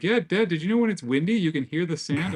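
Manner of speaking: lightly voiced excited son; dad mimics his son's excited discovery; imitating his kid.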